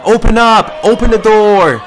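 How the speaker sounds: high, loud call to open up; relayed crowd shout. Retold or imitated. Crowd shouting at the guard to open, loud call.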